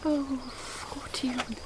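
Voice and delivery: sighing lament. Acted. Juliet alone laments.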